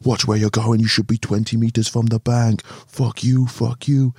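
imitated angry tirade, pitched high; Gasper. Angler berating a boater from the bank, imitated tirade. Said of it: "Gasper's laying into him".

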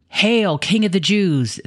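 high, drawn-out mocking hail. A narrator's reading. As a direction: sneer it out like a jeering soldier. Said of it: "they mocked".

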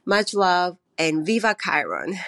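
ordinary talk

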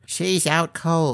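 unbothered little gnome; gnome's voice. A narrator's reading.

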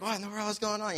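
high-pitched imitation of a panicking student; mock panic. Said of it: "he's panicking. He's going crazy".

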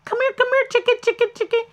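high coaxing come here chicken; chicken calling. Retold or imitated. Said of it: "they're like".

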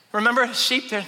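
normal talking voice

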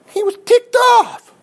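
high, slow, loud burst of anger; ticked off. Acted. Preacher voicing the king's anger, high and slow. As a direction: let the fury spill out high and drawn. Preacher says king was angry.

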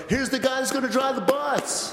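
very high mock cheer; whole room hailing. Everybody greeting the new driver at the meeting, mock cheer. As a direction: imitate a crowd's high-pitched holler. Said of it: "everybody's going".

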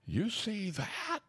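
high, drawn-out question; stretched, high-pitched mock holler; mock loud call. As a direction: call it out high and slow, not truly loud. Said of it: "real loud".

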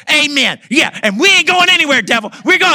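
high, loud cheer of defiance; imitating wife's defiant shout. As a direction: belt it out high, like a wife backing up the fight. Voices Sally shouting defiance at devil.

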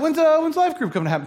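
high, fast, eager mock question; men impatient for group to start, high and quick; impatient asking. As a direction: rattle it off like an itching friend.

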